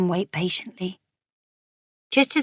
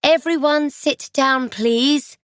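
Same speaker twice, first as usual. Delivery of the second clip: higher-pitched call to a group; 7 semitones higher, 3 dB louder, slower than usual. A narrator's reading. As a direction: call the children to order. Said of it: "Theo's mummy calls out".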